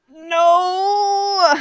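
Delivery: a sudden high, loud no; mock loud no. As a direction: blurt it back like a defiant kid. Mock reply to dad at dinner.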